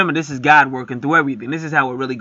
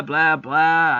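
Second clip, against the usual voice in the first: ordinary talk, nothing marked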